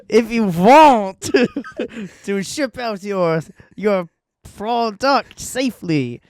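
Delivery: high, slow hammy vampire voice. Pretend. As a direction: ham up a cartoon vampire. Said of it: "You turn French ... come out vampire".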